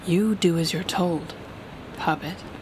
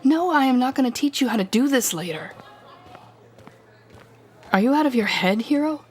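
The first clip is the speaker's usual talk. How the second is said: scornful outburst; 6 semitones higher than usual. Acted. Villain angrily scoffing at the hero's hope of going back. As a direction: rise up and scoff at him hard.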